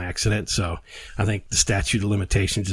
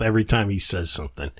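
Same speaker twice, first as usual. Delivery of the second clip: usual speaking voice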